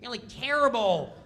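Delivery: high, nagging needling voice; imitating Kattan's high taunting nag; mocking Kattan. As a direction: pick at Norm in a high whine. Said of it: "Kattan starts".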